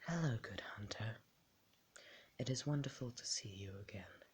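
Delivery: soft, hushed doll welcoming the hunter; made-up doll voice. Acted. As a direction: greet them softly as a gentle doll. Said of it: "make up a different voice".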